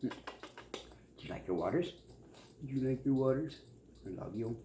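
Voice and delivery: soft, tender cooing to the dog. Real, not acted. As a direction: coo lovingly at your pet. Baby talk to dog.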